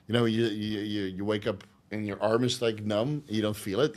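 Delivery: ordinary talk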